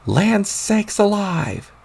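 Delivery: put-on high, very slow folksy old-man exclamation; drawn-out high old-timer wonder. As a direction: savour every word like an amazed grandpa. Old grandpa exclaims while powering up.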